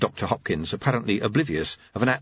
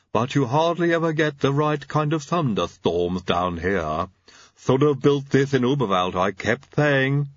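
slow, lisping servant voice, pitched up; lisping lab assistant's complaint; Igor; 4 semitones higher than usual. Acted. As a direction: grumble it slowly with a lisp.

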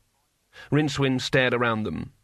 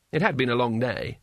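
usual speaking voice

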